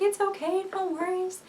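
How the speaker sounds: very high, sweet little voice; imitated sweet reassurance. Sister-in-law reassures the crying labouring woman, imitated sweetly. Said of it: "she's the sweetest ever".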